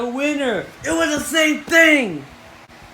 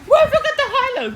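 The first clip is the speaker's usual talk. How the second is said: much higher, fast voice, laughing; laughing squeak; 12 semitones higher, faster than usual. Acted. Laughing mock voice.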